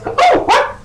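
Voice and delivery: very high, loud squeal; piercingly high-pitched pony voice; pink pony. Acted. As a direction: squeal it like an excitable cartoon pony. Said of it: "the pink pony goes".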